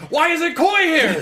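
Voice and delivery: very high, slow, laughing squeal. Acted. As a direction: squeal it like a giddy fan. Excited fan squeal about actress.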